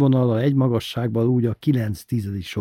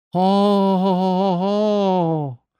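slow, drawn-out high mock ha ha; imitating the roar; 10 semitones higher, 4 dB louder, much slower than usual. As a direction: copy the roar you heard, stretched out. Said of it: "utánozta az üvöltést Sanyó bácsi".

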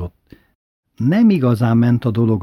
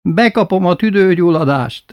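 higher, urgent granny voice; pleading grandmother; 7 semitones higher, 4 dB louder than usual. Retold or imitated. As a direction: threaten to catch pneumonia. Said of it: "Ez hatott".

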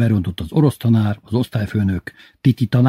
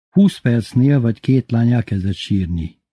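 plain reading voice

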